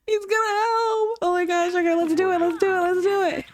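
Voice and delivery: high-pitched thrilled cheering on; excited squeal. Real, not acted. Squealing as the baby ages up.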